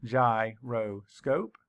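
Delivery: Gyroscope said slowly, gy-ro-scope; syllables split. Shown as a demonstration. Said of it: "Count the syllables".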